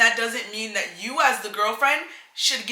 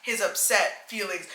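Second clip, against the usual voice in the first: normal talking voice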